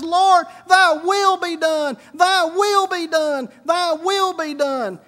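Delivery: slow, high, loud surrender to God; preacher acting out a desperate, shouted prayer; impassioned cried-out prayer. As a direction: cry out each phrase with fervor. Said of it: "and he's crying, and his son's crying. He says".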